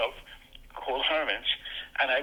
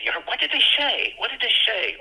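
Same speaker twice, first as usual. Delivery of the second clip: much higher, eager repeated question; eager kids asking; 10 semitones higher than usual. Retold or imitated. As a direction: press for the news like an impatient kid. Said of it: "they would say".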